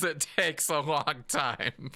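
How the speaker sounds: slow, laughing through the words. Real, not acted. Interviewer teasing guest about speed, laughing. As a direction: chuckle while teasing him, slowly.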